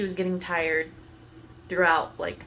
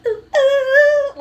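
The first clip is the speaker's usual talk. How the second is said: high, loud excited ooh-ing; mock-excited squeal; imitating excited child; 10 dB louder than usual. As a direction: whoop like an excited kid in the backseat. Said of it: "like, she was all excited".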